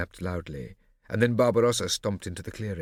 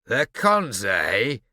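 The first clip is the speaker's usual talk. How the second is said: sneering; 3 semitones higher, 8 dB louder than usual. A narrator's reading. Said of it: "the deep voice sneered".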